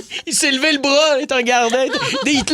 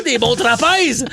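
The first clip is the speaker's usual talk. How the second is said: normal talking voice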